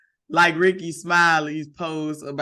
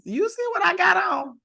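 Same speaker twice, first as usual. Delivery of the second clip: high playful shout; 12 semitones higher than usual. Real, not acted. Complaining about cold weather outfit, playful high shout. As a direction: call it out to the sky.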